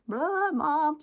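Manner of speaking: put-on high whiny kid voice. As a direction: pipe up like a pestering child. Child interrupting, voiced by mom.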